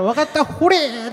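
high, slow mock surrender; grudging wife. Wife reluctantly obeying her husband, hammed-up resignation. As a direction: give in with a drawn-out ほれー. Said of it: "奥さんがしょうがねえから".

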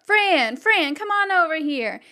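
sheriff calls Fran across the room, loud call. Acted. Sheriff shouting to Fran across the party, loud call. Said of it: "he shouts at Fran across the way".

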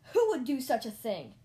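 very loud outraged question; full-voiced outburst; shouting. A narrator's reading. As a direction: cry out in indignation. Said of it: "I shouted".

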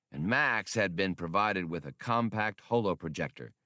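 usual speaking voice

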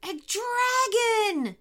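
high, long-drawn terrified cry; panicked scream. A narrator's reading. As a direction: shriek the alarm, stretched out. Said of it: "screamed the lead dingo".

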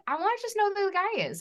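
high, quick and eager; eager younger self. Retold or imitated. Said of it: "I was like".

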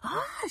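thin high "ah" of a retold yell; imitated yell. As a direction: imitate her scream with the volume held back. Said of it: "she was, like, yelling".

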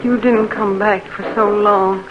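ordinary delivery

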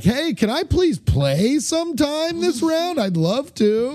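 high, slow sarcastic politeness; fake nice. Losing player being shitty through niceness, mock-polite plea. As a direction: plead with exaggerated false sweetness. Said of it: "act nice".

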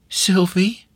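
squeezed out high with effort; a timid child's rising reply; shy little girl. Acted. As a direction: force her own name out shyly. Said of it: "with much effort".

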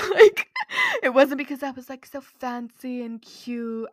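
laughing. Real, not acted. Podcaster admitting her embarrassing mistake to listeners, laughing. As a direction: crack up at your own mistake.

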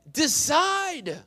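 high, stretched-out single word; drawn-out command. Real, not acted. Preacher pressing waverers to choose, drawn out. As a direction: press it home slowly.

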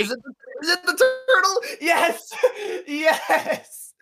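high, loud cheer with laughter; laughing cheer. Real, not acted. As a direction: scream with joy and laugh. Cheers with a laugh, having won.

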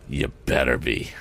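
low, vindictive villain. A narrator's reading. Morse warns the lad, vindictive. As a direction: drop the pitch into understated threat. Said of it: "Morse spoke in a vindictive manner".

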